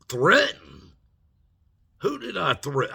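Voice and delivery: loud, high, incredulous outburst; retold indignant disbelief. Student answers security head's accusation, indignant disbelief.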